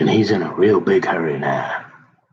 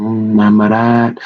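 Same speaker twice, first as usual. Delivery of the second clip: ordinary talk, nothing marked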